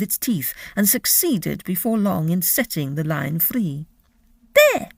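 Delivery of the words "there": very high, loud squeaky cry; triumphant mouse. Acted. Mouse showing the freed lion, high loud triumph. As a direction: crow it out like a proud tiny mouse.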